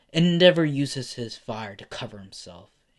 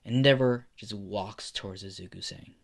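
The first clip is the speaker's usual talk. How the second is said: plain reading voice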